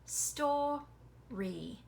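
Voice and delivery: "Story" drawn out in two beats; split syllables. Shown as a demonstration. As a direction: separate "Story" into stor and ree. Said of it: "you hear more than one".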